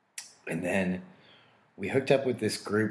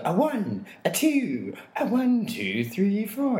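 count-in; 12 semitones higher, 4 dB louder, slower than usual. Retold or imitated. Counting in the band.

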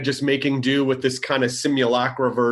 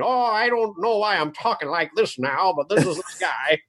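put-on old-time character voice, slightly high; twenties old man; 3 semitones higher than usual. Demonstrating this guy to the co-host, twenties old man voice. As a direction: play an old fellow from the twenties. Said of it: "it's sort of like a twenties old man".

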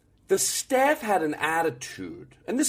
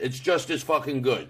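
ordinary talk

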